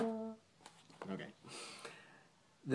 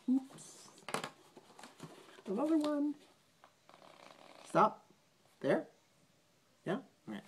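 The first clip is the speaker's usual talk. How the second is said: ordinary delivery, nothing marked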